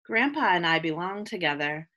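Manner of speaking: ordinary talk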